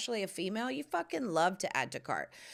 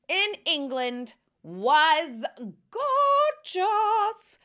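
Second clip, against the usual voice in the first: very slow, loud and much higher on gorgeous; yelling; 8 semitones higher, 7 dB louder, much slower than usual. Real, not acted. Raving to viewers about the weather, yelling. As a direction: stretch gorgeous out in a loud cry. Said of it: "I know I'm yelling".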